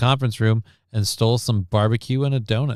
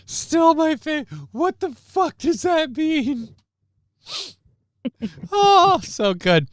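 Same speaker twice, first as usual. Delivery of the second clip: much higher, slow squeal through laughter; laughing; slower than usual. Real, not acted. Cracking up over a movie quote, laughing high. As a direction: giggle through it, delighted.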